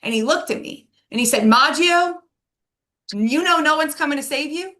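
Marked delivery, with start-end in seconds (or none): 1.5-4.7 retold intense question. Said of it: "He asked as a question".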